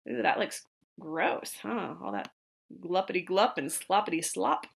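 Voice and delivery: quiet aside. Real, not acted. Soft aside to children.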